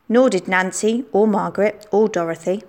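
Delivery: ordinary delivery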